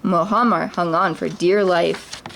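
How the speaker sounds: ordinary delivery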